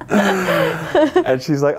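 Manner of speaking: dragged out, slow, still laughing; words stretched by laughter. Real, not acted. Still laughing mid-story.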